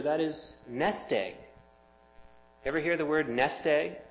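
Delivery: ordinary delivery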